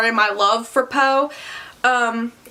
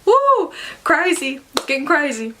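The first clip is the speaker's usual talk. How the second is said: mock excitement; 4 semitones higher than usual. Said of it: "And I'm like".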